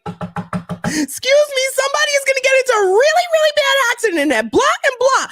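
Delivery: very high, urgent rush of words; frantic alert. Retold or imitated. Running inside to warn about the accident, frantic and high. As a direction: burst in and warn everyone.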